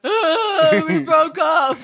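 loud fake sob, pitched high; hammed-up crying confession; mock wailing. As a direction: wail it like a hammed-up breakup. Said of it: "And you're like".

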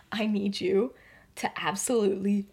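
low, hushed, deliberate demand; deadly serious. Retold or imitated. She looks James dead in the eyes, deadly serious. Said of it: "looks him dead in the eyes".

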